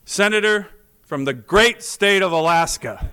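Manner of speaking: proud, projected call from the chair; grand announcement. Retold or imitated.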